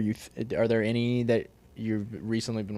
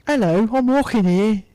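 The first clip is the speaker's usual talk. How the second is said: put-on high, loud joke take on a York accent; Old York accent; 10 semitones higher, 8 dB louder than usual. Friend asked for New York, gives mock Old York accent. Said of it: "Old York".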